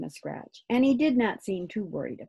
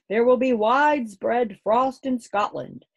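loud, higher weather forecaster delivery; projected broadcast voice, pitched up; radio announcer; 6 semitones higher, 6 dB louder than usual. A narrator's reading. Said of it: "said a voice".

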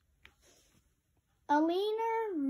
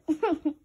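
giggle; 6 dB louder than usual. Real, not acted. Laughs before "tricked you.

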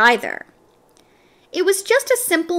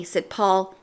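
ordinary delivery

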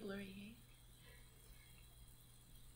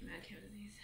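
whispered; 17 dB quieter than usual. Real, not acted. Muttered to herself.

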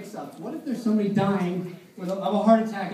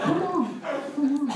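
pleading Mickey; 8 semitones higher, slower than usual. Acted.

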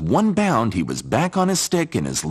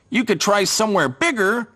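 much higher chirpy bird voice; sparrow voice; 12 semitones higher than usual. Acted. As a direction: tweet it like a sparrow. Sparrows suggest.

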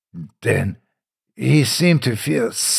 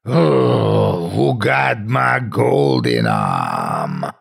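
low, slow ghostly moan; 6 semitones lower, slower than usual. A narrator's reading. Ghost coming after the man, low slow moan. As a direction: draw it out like the dead calling. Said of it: "it is coming after him".

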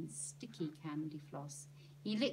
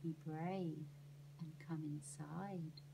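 slow, softer fortune teller voice; lowered voice; 4 semitones lower, 7 dB quieter, slower than usual. Acted. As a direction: draw him in, hushed and slow. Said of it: "She lowered her voice".

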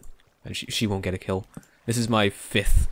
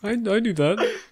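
mock self-quote; 10 semitones higher than usual. Echoing friend's mocking quote.

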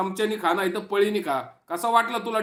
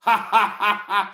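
mock demon laugh; slower than usual. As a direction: ham up the rival's cackle in slow beats. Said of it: "अरे खायसासारखा हसतो तू".

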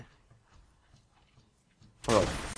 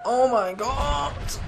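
amazed; 12 semitones higher, much slower than usual. Real, not acted. Amazed at the game outcome.

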